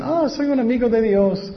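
high-pitched mock pious claim; self-declared believer. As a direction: imitate people boasting, pitched well up. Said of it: "Muchas personas dicen".

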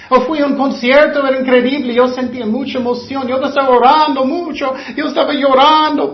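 mimicking emotional worshippers with breathless awe; mock gushing excitement. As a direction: act the thrilled concertgoer. Voicing a concert-high believer.

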